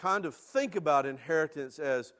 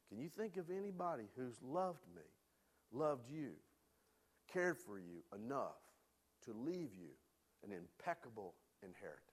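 tender; 2 semitones lower, 12 dB quieter than usual. Real, not acted. Tender appeal to congregation.

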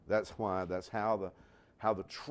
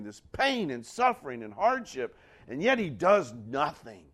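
higher, louder building complaint; pleading lament; 4 semitones higher, 6 dB louder than usual. Retold or imitated. Preacher voicing weary believers pleading with God, loud and rising. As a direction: pour out years of unanswered prayer.

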